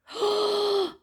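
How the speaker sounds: high-pitched excited gasp; startled gasp. Real, not acted. Vlogger hears something arrive, high startled gasp.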